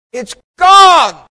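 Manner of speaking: high, very loud cry of release; jubilant shout. Retold or imitated. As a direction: shout it out in amazed triumph. Retold deliverance, building to a yell.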